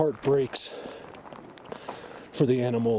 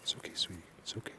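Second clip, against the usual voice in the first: whisper to fawn; 6 semitones lower, 15 dB quieter, faster than usual. Real, not acted. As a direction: whisper it softly so the fawn stays calm.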